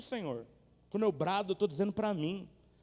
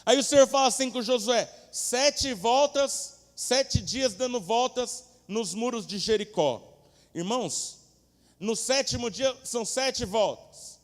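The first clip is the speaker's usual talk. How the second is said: loud, high, slow proclamation; booming command; 8 semitones higher, 11 dB louder, slower than usual. Acted. Preacher voicing God's command to Joshua, loud and slow. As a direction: stretch out "Sete voltas" like an order.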